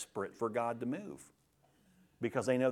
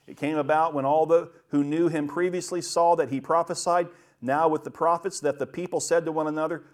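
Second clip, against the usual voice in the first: loud, emphatic Bible reading; scripture reading; 3 semitones higher, 6 dB louder than usual. A narrator's reading. Preacher reading Saul's story to the congregation, loud and emphatic. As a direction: proclaim the verse at a raised volume.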